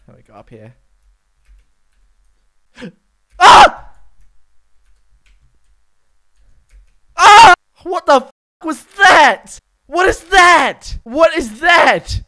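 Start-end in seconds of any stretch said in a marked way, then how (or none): 3.4-7.5 high, loud short shriek; startled scream. Real, not acted. Startled in cave. 7.8-12.2 loud, high, stretched question; startled shouting; alarmed disbelief. Real, not acted. Alarmed by unknown creature.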